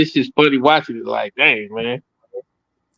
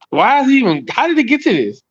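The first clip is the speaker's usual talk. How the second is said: mock baffled wife; 9 semitones higher than usual. Wife's puzzled look voiced.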